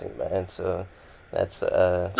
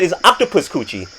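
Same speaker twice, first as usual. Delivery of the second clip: mock African accent, pitched up and laughing; 6 semitones higher than usual. Quoting friend's dad at dinner, mock African accent. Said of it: "strong African accents".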